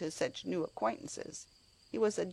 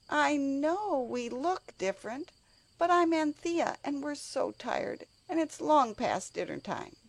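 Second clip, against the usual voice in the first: pleading; 10 semitones higher than usual. Acted. Anthea pleads, tired and hungry.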